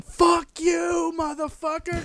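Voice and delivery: high hollered mock insult; jeering crowd. Crowd hates the performance, jeering holler. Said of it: "everyone in the crowd is just going".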